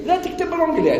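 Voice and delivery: high rebuke to the shop owner; mock scolding.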